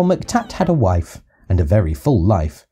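ordinary delivery

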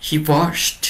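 "washed" ending in a clear T; teacher's model of "washed" with a T ending; final T in "washed". Shown as a demonstration. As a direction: say "washed" as "wosht", voice lifted. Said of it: "ออกเสียง T".

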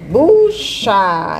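"Bucha" stretched into buuu-chaaa; "Bucha" sounded out slowly for a pupil; syllable by syllable. Shown as a demonstration. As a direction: draw out both syllables of "Bucha". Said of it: "duas sílabas pra formar a palavra".

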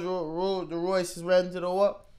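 ordinary talk, nothing marked

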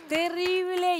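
ordinary talk, nothing marked

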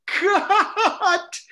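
high, loud call broken by laughter; Peter DeLuise. Retold or imitated. Director cracking up as he ends the take, laughing shout. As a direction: shout it through helpless laughter. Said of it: "He was laughing his ass off".

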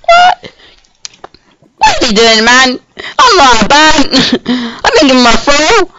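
high-pitched put-on protest; hammed-up high voice of disbelief; mock indignation. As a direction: act out a squeaky-high indignant reaction. Acting out reaction to being found.

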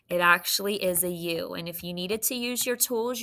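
ordinary delivery

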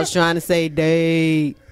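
loud, held-down monotone; deliberately level, forceful correction; voice brought down. Shown as a demonstration. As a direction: insist loudly without letting the voice rise. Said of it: "why your voice going up".

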